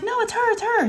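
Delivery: very high-pitched mock insistence; retold screaming. Daughter insisting it's her hamster, screaming. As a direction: squeal it like a frantic kid. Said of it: "So she keeps screaming".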